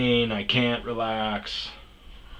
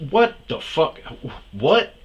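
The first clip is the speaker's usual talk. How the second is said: retold outraged outburst; 5 semitones higher, 5 dB louder than usual.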